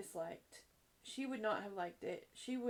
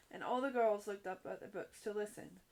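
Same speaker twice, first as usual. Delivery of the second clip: plain reading voice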